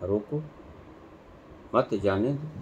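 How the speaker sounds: "रोको" alone, long pause, then "मत जाने दो"; pause after रोको. Shown as a demonstration. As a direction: break the phrase straight after "रोको". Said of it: "तो लिखा". ("रोको" के बाद विराम, फिर "मत जाने दो")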